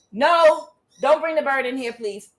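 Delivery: sharp high "no", then urgent plea; real urgent protest; screaming. Real, not acted. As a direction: call out to stop the cat, pitched high. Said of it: "That's when I started screaming".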